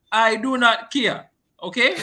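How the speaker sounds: slow, high, loud "I do not care"; emphatic dismissal. Real, not acted. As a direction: space out each word of "I do not care". Refuses the argument emphatically.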